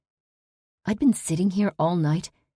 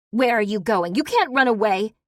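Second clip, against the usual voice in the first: higher, loud furious yell; yelling after her; 6 semitones higher, 6 dB louder than usual. Acted. Daughter yells at the fleeing parolee, loud and angry. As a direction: shout after someone fleeing.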